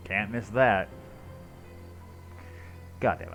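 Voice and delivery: ordinary delivery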